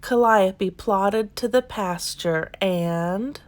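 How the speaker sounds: usual speaking voice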